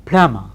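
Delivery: "Plumber" as plum-a; "Plumber" with b silent. Shown as a demonstration. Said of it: "Has the plumber come?".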